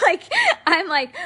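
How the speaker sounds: high, loud laugh breaking through the words; real laugh bubbling up mid-sentence. Real, not acted. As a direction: laugh while getting the words out. Laughs at own defiant remark.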